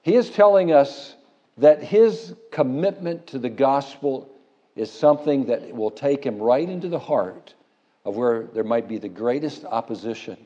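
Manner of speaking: usual speaking voice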